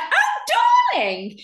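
a high, gushing "Oh, darling" like a theatrical agent. Retold or imitated. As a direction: swoop way up on "Oh" to play a fawning agent. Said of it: "And she was like".

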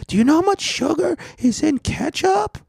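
very high, squeaky mimicry of an outraged customer; mock outraged shriek. Customer fuming at staff over ketchup, mock outraged shriek. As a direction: imitate her in a tiny, very high voice.

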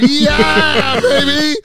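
high, loud victory whoop; excited yell. Real, not acted. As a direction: cheer it out like a win. Celebrating the review.

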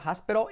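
"hospital" as HAS-pero; pronunciación correcta. Shown as a demonstration. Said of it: "como una R suave española". ("hospital" como «jáspero»)

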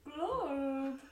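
single fish glub; gurgling fish man croak. Pretend. Playing Mister Fish.

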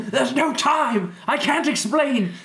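high, slow, urgent dramatic voice; future self. Pretend. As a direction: command it like a desperate time traveller. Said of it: "Me from the future".

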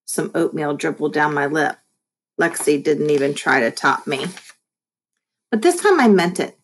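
ordinary delivery, nothing marked

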